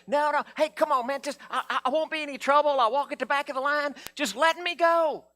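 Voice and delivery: put-on healed man begs Jesus like a kid in the back seat, high pleading.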